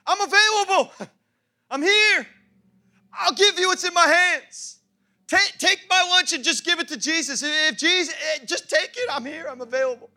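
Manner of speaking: high, loud, wholehearted offering; urgent high-pitched childlike plea; eager little boy. Acted. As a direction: cry it out like a kid giving everything. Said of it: "He's like".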